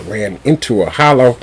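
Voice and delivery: plain reading voice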